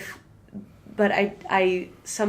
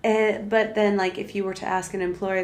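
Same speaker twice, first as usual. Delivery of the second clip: ordinary talk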